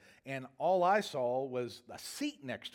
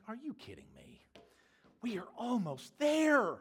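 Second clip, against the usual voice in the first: exasperated disbelief; 8 semitones higher than usual. Retold or imitated. Stuck plane turning back to gate.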